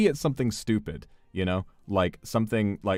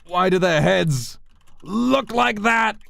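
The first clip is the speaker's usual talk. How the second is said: much higher, slow, frantic pleading; panicked George; 12 semitones higher, 5 dB louder, slower than usual. Acted. George begs the officer to see the long heads, terrified. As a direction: cry out in wide-eyed terror. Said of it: "George can hit back with".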